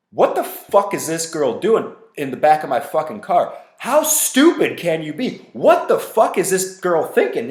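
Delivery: put-on complaining loudly to Patty so the girl hears; loud passive-aggressive rant. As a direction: vent loudly to the friend beside you. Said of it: "talking to Patty about this girl very loud so she could hear".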